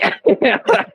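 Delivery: bursts into a high laugh at his own story; a quick, high amused laugh. Real, not acted. Laughing at the cop story, a high laugh.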